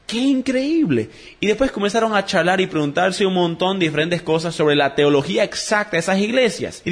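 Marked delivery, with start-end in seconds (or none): 0.1-1.1 slow, much higher marvelling over each word; amazed. Acted. Airport stranger mock-amazed at the shared church, slow and high. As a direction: drag out the wonder, mock-amazed. Joke character marvels again.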